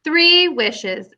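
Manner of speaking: usual speaking voice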